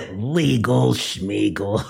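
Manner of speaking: high, giggling menacing villain; creepy villain voice. Acted. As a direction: gloat like an unhinged captor.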